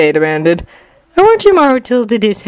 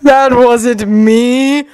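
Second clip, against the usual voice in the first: drawn-out, higher-pitched villain protest; protesting Orochimaru; 6 semitones higher, slower than usual. A narrator's reading. Orochimaru denies it once more, drawn-out indignant voice. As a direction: stretch out the indignant denial.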